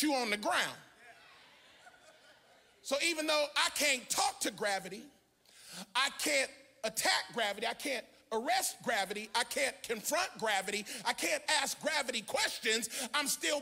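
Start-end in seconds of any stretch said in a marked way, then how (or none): none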